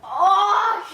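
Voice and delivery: sudden loud startled oh; surprised shout. Real, not acted. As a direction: yelp at the sour hit. Reacts to sour candy.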